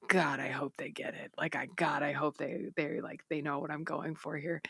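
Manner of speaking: hushed, worried inner voice; nervous murmur; 1 anxious whisper. Retold or imitated. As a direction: whisper your hopes to yourself.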